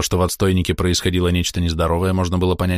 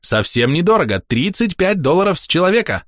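high, insistent salesman pitch; pushy tout; 9 semitones higher than usual. A narrator's reading. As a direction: keep pushing the cheap deal. Tout pressing the sale.

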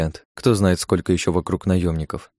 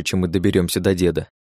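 ordinary delivery, nothing marked

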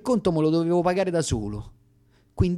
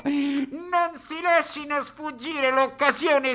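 fairground barker; 10 semitones higher than usual. Acted. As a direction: sell the freak show to passers-by.

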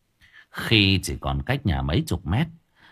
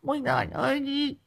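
very high shrill wailing woman, lisping; very high wailing lament of an injured wife. A narrator's reading. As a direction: rant and moan like an injured furious wife. Injured wife wails at husband.

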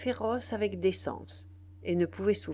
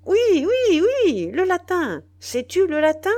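very high, loud, rapid-fire repetition; impatient insistence; 11 semitones higher, 12 dB louder than usual. A narrator's reading. Chief repeating his question to a baffled soldier, impatiently. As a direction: hammer it home at full voice.

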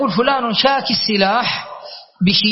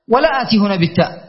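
ordinary talk

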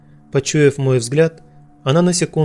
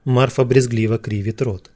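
ordinary delivery

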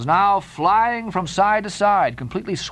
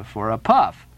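ordinary delivery, nothing marked

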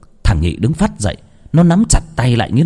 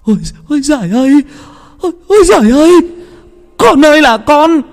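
much higher, loud anguished wailing; a mother collapsing in grief; keening lament, fast and shrill with grief; wailing sobs; 4 dB louder than usual. A narrator's reading. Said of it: "Bà vật ra đất mà khóc".

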